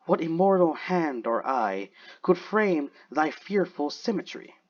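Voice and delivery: slow, drawn-out dramatic poem reading; poetry recitation. Acted. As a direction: recite it solemnly, stretching each phrase. Recites Blake's poem aloud.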